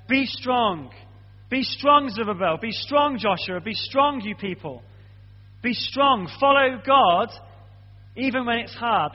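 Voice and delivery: urgent and building, Be strong rising each time; building sermon exhortation. Acted. Preacher urging the church to be strong, rising intensity. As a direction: hammer it home to the congregation.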